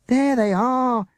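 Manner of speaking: high, loud, slow shriek of relief. Acted. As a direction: shout it across the fields. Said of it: "Jessica shrieked".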